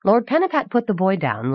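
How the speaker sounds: ordinary delivery, nothing marked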